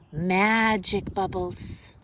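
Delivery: ordinary delivery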